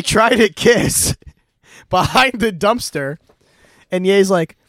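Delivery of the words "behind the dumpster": ordinary talk, nothing marked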